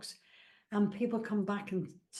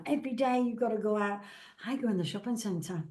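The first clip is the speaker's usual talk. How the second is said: normal talking voice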